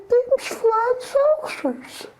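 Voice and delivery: slow, high, tearful; trembling slow cry; shaken. Retold or imitated. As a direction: say it like you're scared to death. Shaken grandma repeating, crying sound.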